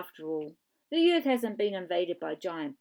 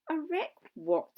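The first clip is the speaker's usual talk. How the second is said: puzzled; 7 semitones higher, slower than usual. Acted. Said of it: "said Max".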